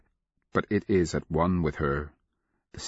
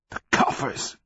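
high-pitched madman's croak; mad croak; 7 semitones higher than usual. A narrator's reading. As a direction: croak it like a crazed old soldier. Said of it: "the mad scalper croaked".

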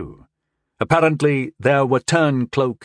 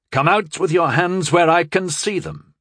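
raised, firm command; higher-pitched, authoritative command; commanding order; 6 semitones higher than usual. A narrator's reading. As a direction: call it out as an order. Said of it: "he ordered".

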